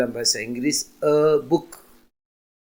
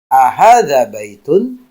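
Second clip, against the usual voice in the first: Ahadza baitun asked louder and slower; teacherly Arabic yes-no question; question with added a; 6 dB louder, slower than usual. Shown as a demonstration. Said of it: "tinggal menambahkan a di depannya".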